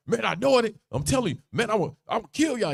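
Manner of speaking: high-pitched mock tirade; hot, going off. Peter denying a third time as he begins to curse, heated outburst. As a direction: rant as if cursing everyone out. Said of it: "He's just going off. He's hot".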